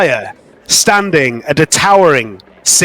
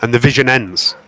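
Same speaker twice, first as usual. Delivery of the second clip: game master narration; 4 semitones lower than usual. A narrator's reading. Game master describing a goddess vision, hushed narration.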